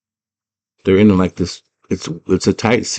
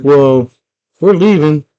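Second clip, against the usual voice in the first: high, louder mock-dad call; raised-voice parental send-off; 9 semitones higher, 5 dB louder than usual. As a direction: imitate a dad calling through a door as he leaves. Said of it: "He's like".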